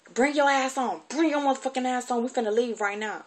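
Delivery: high, loud angry command, imitating her aunt; furious shout. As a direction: order the kid out, seething. Auntie ordering her out.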